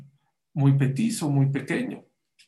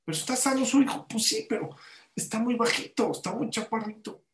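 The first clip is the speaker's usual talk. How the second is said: anxious protest; 8 semitones higher than usual. Retold or imitated. Retold exchange with worried mother.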